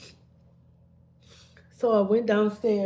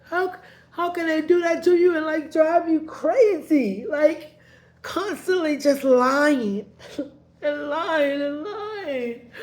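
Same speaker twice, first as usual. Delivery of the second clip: tearful outburst; 8 semitones higher, 6 dB louder than usual. Real, not acted. Upset outburst about his lying.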